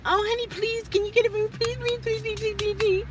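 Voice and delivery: high pleading beg; begging. Retold or imitated. Begging man for something.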